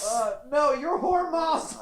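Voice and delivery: high, slow fake whimper; mock crying. Teased partner fake-crying back, high and slow. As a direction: whine as if about to cry. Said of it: "I made you cry".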